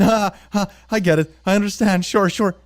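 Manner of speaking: nervous laughing. Acted. Nervous forced cheer.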